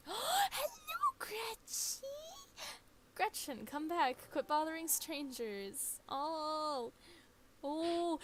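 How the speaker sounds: high and loud, calling after a pet. Acted. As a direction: call out to her in a high voice. Character calling after her runaway pet.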